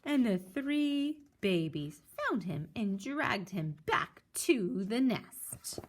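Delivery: ordinary delivery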